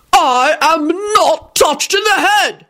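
slow, high indignant scream; high-pitched furious protest. A narrator's reading. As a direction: screech it out word by word. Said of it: "Richard screamed".

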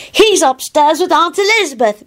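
put-on high little-kid voice, full of importance; self-important child. A narrator's reading. Teddy informs Kingsley, self-important. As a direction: announce it high, like a proud small child. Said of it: "Teddy said importantly".

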